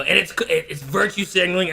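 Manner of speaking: ordinary talk, nothing marked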